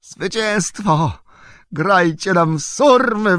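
high, drawn-out cry of victory; loud, jubilant, stretched-out cheer; exultant shout. A narrator's reading. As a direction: proclaim a triumph at full pitch. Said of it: "wykrzyknął".

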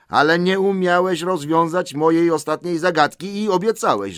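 high, loud reproach; insistent protest. Acted. Bilbo reminds Gollum of his promise, insistently.

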